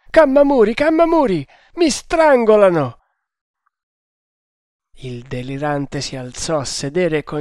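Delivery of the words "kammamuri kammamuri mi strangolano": loud, high scream for help; frantic, high-pitched calling out; panicked cry. Acted. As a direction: shriek to your servant as if being strangled. Delirious man calls for help.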